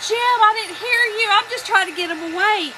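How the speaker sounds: retold pleading, high and loud; mock-defensive protest to Jim; flustered excuse. Retelling her flustered plea to Jim.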